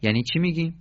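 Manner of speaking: ordinary delivery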